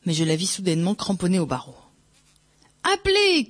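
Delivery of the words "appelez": very high, urgent cry; cried out. A narrator's reading. Éponine crying to the abbé to call, shouted. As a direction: cry out for help, pitch leaping up. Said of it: "cria-t-elle".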